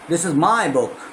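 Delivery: my hit clearly; stress on my. Shown as a demonstration. Teacher drilling stress on my. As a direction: put the weight on my. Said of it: "इस तरह से आपको करना पड़ता है".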